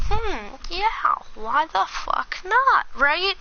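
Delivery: pitched well up, a drawn-out hmm of mock consideration; high mock musing. To a friend about dating her friend, high mock musing. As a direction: play it like a shrugging yes, voice up high. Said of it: "And I'm like".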